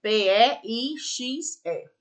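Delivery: slow dictation, one letter at a time; spelling out. Shown as a demonstration. Teacher dictates a fish's name to pupils, spelled slowly. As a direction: space each letter out for pupils writing along.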